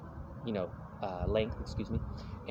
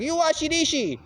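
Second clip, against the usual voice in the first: very high, loud mock Chinese accent; 6 dB louder than usual. Dishwasher orders broke diner to wash dishes, mock Chinese accent. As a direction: bark it as a caricatured Chinese dishwasher. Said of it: "Chinese dishwasher guy's like".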